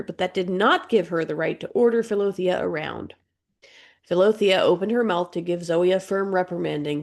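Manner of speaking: ordinary delivery, nothing marked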